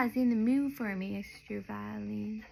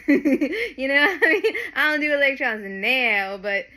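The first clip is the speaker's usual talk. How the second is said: loud exclaim; 11 dB louder than usual. Real, not acted. Joking to viewers about skipping electrons, a loud exclaim. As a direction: belt it out as a laughing punchline.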